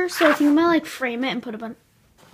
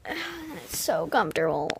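sleepy whisper; 6 semitones lower, 6 dB quieter than usual. Real, not acted. Lying in bed, sighing.

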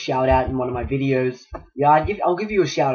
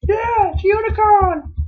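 very high, louder squeal of joy; excited squeal; 4 dB louder, slower than usual. Real, not acted. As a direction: shriek it with delight. Finding the unicorn again.

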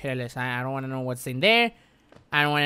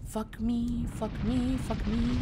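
high, soft repeated plaint; nervous muttered cursing; 6 semitones higher, 5 dB quieter, slower than usual. Real, not acted. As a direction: repeat it small and high under threat. Nervous self-talk in game.

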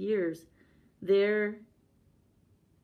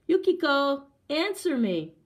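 loud, high, impatient shout; demanding call; 8 semitones higher, 7 dB louder than usual. A narrator's reading. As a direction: demand a reply from behind the screen. Husband calls, demanding an answer.

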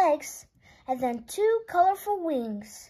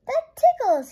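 much higher and louder, tickled and delighted; giggly delight; 10 semitones higher, 8 dB louder than usual. Acted. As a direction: squeal it like a ticklish kid. Butterfly lands on Pete's nose.

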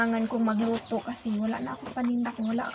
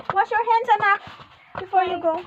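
firm call; 8 semitones higher, 7 dB louder than usual. Real, not acted. Mom instructs child.

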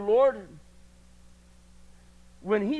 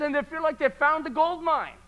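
ordinary delivery

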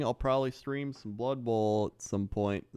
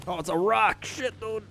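sudden high yelp at a crash; startled shout; 11 semitones higher, 4 dB louder than usual. Real, not acted. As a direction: yelp as you hit the rock. Crashes into rock while gaming.

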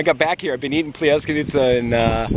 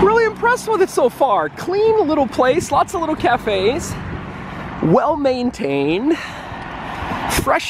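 impressed; 12 semitones higher than usual. Real, not acted. Tourist praising the town.

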